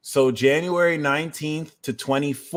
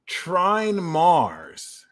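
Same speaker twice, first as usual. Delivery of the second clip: normal talking voice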